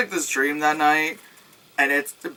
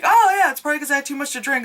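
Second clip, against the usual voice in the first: dismissive quick mock; 8 semitones higher, much faster than usual. Voicing the character's thoughts.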